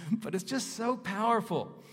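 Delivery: high and loud, leaning on so; enthused. Real, not acted. Preacher telling the congregation how powerful the passage is, excited. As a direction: gush about how powerful it is.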